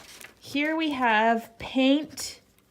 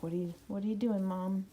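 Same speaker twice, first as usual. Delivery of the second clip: voicing the dog; 5 semitones lower, 6 dB quieter, much faster than usual. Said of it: "he's like".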